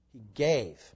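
"gave" set apart and weighted, in a low voice; low, deliberate weight on "gave"; stress on GAVE. Real, not acted. Preacher underscores the point, stressing GAVE. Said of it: "Underscore that".

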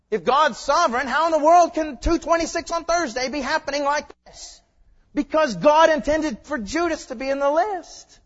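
loud, rising protest; indignant challenge. Acted. Believer challenging preacher about a hard Thursday, indignant. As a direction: build to a high, loud complaint.

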